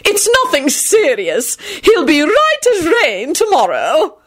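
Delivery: high, slow grande dame; haughty. A narrator's reading. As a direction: draw it out imperiously. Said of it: "she announced haughtily".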